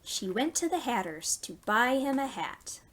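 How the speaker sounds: gentle storytime rhyme; reading to children. Acted. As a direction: read it to little ones, pausing for them. Reads a nursery rhyme.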